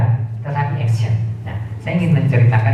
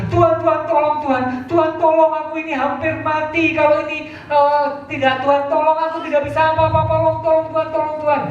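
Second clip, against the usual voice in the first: begging for rescue; 14 semitones higher than usual. Acted. Climber begs God for rescue.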